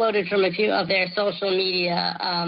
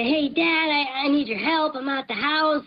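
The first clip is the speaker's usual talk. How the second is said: higher voice as the roommate; roommate's cloned voice; 6 semitones higher than usual. Retold or imitated. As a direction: act as the roommate calling his dad. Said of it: "this is with your roommate's cloned voice".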